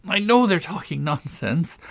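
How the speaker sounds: put-on high, light girl's voice; lightly voiced girl thinking; Alice's thought. A narrator's reading. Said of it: "Alice thought to herself".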